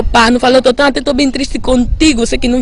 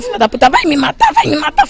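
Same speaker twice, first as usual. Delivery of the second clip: higher, panicked cry that she'll kill him; alarmed outcry; 6 semitones higher, faster than usual. Retold or imitated. Attacker crying out as she fights back, higher and alarmed. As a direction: yelp it in alarm as he's grabbed.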